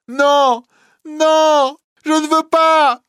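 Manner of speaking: very high, loud screams of refusal; terrified shriek. Acted. Bohémienne with rope round neck, screaming terrified. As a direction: shriek in sheer panic.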